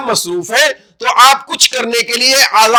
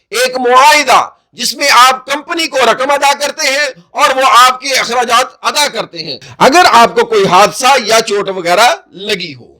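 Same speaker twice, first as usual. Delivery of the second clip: ordinary delivery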